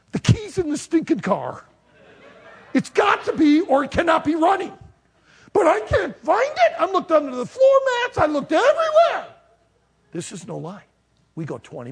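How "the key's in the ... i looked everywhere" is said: high, loud exasperated yelling; getting angry. Retold or imitated. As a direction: build from irritated outburst to frantic shouting. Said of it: "And I'm getting angry".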